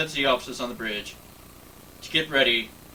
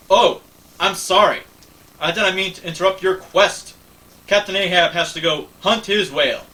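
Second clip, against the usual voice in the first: loud sarcasm; 6 semitones higher, 7 dB louder than usual. Acted. Lily mocking Picard as Captain Ahab, loud and sarcastic. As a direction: sneer the Ahab jab.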